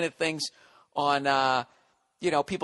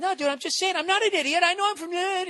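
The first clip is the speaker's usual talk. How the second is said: high, far-off put-on voice, hammed-up protest; mock whiny friend. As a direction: play the panicky friend insisting he's no idiot.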